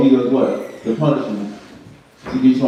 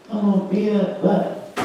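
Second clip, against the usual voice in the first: mock jealous threat; 9 semitones higher than usual. Voicing a jealous person's threat.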